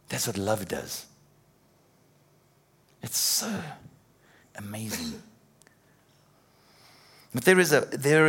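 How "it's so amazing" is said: awed hush. Real, not acted. Awed over love.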